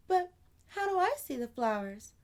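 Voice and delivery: put-on high little girl, sadly. Amani grieving her dead flowers, high childlike voice. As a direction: play a small girl mourning her flowers.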